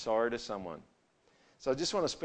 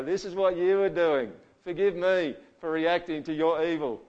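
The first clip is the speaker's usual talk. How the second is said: accusing apology; 5 semitones higher, 5 dB louder than usual. Retold or imitated. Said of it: "you just go".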